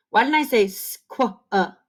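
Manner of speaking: usual speaking voice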